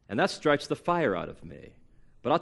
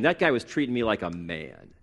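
ordinary talk, nothing marked

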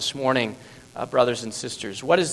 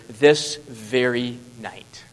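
slow deliberate; 4 semitones lower, much slower than usual. Acted. Preacher quoting Jesus, slowed.

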